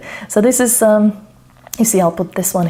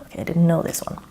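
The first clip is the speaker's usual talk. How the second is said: whisper; 6 dB quieter, faster than usual. Real, not acted. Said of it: "aside to self".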